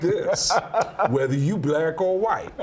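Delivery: slow Bronx drawl, a Tracy Morgan impression. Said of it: "everybody in the Bronx sounds like Tracy Morgan".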